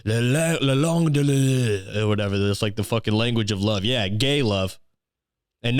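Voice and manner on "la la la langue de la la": exaggerated French accent, lilting on "la"; mock French. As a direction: make fun of swooning over French. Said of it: "thinks French is, like, a good".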